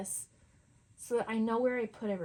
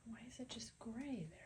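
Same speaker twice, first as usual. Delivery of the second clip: whispering; 13 dB quieter than usual. Real, not acted. Muttering at the camera.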